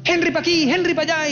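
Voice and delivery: high, pushy orders; mock the boss barking orders; bossy boss. Mocking the boss who bossed him around, high bossy voice.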